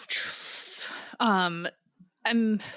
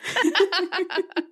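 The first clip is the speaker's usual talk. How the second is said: real laughter; 7 semitones higher than usual. Real, not acted. Laughing at joke.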